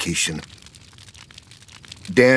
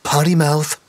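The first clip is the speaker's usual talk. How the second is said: put-on high childish scolding voice; little girl scolding; 8 semitones higher than usual. A narrator's reading. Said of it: "the little girl berated".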